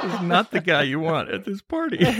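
chuckling through the words; amused, laughing while talking. Real, not acted. Host joking about a clip.

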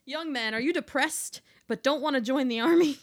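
loud, brash infomercial pitch; loud mock ad pitch. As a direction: sell it like a cheesy ad.

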